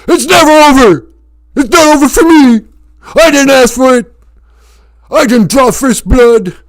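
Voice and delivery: high, loud anguished yelling; Stallone meltdown shout. Acted. Veteran screaming at the sheriff, anguished shout. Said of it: "sounded exactly like Sylvester Stallone".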